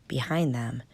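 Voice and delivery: ordinary delivery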